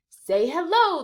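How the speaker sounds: high, loud chorus call; class yelling. Acted. Students yelling at Joe to greet, loud. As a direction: yell it together. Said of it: "the students yell".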